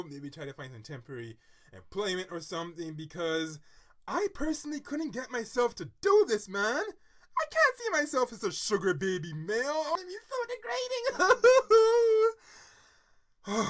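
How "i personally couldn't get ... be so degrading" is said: loud, high, overwrought protest; melodramatic horror. Real, not acted. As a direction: wail at the very thought. Drama-queen horror at doing it herself.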